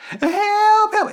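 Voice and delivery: very high, loud wail; mock cry for help. New victim crying for rescue. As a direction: squeal it like a fake victim. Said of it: "former bad guy becomes the victim".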